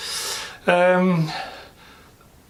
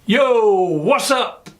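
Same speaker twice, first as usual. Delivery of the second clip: American vlogger greeting; 7 semitones higher, much slower than usual. Retold or imitated. Vlogger imitating American YouTubers' opening. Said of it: "tend to start off with".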